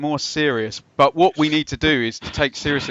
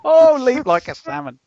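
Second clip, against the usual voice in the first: excited; 10 semitones higher, 5 dB louder than usual. Real, not acted. Punning on player name.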